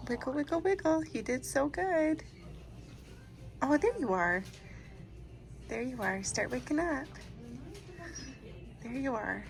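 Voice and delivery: soft, soothing wake-up voice; gentle coaxing. Real, not acted. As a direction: coax him awake tenderly. Waking husband after anesthesia.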